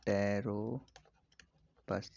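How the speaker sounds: Pteropus stretched, with a gap before the end; slow dictation. Shown as a demonstration. Teacher repeats flying fox's name for students, very slowly. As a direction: drag out Pteropus in separate parts.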